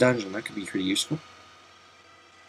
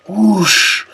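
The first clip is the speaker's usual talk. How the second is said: a higher, breathy whoosh mimicking a gust of wind; playful spoken wind sound; 8 semitones higher than usual. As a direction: blow it out like a spell effect. Voicing the whirlwind spell.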